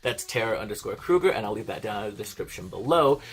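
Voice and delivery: fast, loud, peppy sign-off; upbeat vlog outro. Acted. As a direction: rattle through the outro pitch like a host. Closing the video, plugging socials.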